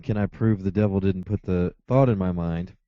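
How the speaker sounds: usual speaking voice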